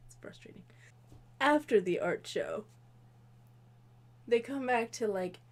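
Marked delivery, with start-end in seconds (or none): 0.1-0.6 even softer, trailing whisper; fainter whisper. Real, not acted. Repeating the complaint to viewers, fainter whisper. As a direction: let it fade to nothing.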